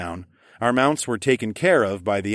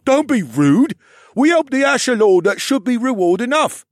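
put-on high, loud scolding kid; scolding boy voice; 9 dB louder than usual. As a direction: scold your brother. Beryl scolds his brother.

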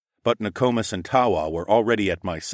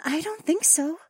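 high, soft wary voice; wary bird companion; 3 dB quieter than usual. A narrator's reading. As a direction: voice quiet suspicion. Companion replying.

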